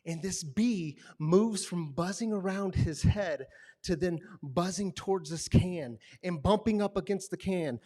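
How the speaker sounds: ordinary talk, nothing marked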